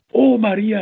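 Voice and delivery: high, loud fervent call to heaven; nun crying out fervently to Mary. A narrator's reading. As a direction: cry out to Mary with all your soul. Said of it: "rief sie voller Inbrunst".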